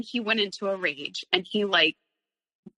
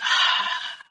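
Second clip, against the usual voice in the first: long audible breath out in relief; imitated exhale. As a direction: let the air out like a weight lifted. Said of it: "he, like, exhaled".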